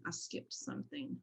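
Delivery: breathy whisper to herself; whispered aside. Real, not acted. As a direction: mutter it under your breath, half to yourself. Muttering to herself mid-slide.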